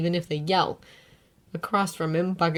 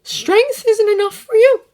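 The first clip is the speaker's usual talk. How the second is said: much higher, trembling reply; shaking voice; 9 dB louder than usual. A narrator's reading. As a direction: answer with a quaking voice. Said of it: "voice shaking".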